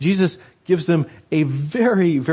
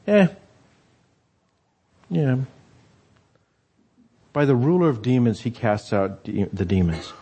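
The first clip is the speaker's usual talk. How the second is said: dismissive scoff. Retold or imitated. Pharisees dismiss the miracle.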